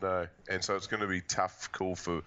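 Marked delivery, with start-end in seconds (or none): none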